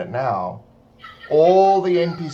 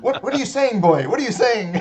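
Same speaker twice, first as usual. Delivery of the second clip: higher, louder mocking villager; gruff NPC voice; 9 semitones higher, 5 dB louder than usual. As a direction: sneer at the dog like a villager. Mock NPC scolding the dog.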